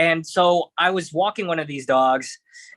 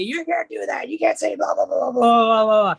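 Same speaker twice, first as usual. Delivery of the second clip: mock phone rant; 6 semitones higher than usual. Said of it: "He starts screaming at me".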